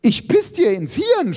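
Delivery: high-pitched furious shriek; screaming. A narrator's reading. Iris threatening her opponent, screaming. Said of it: "schrie Iris".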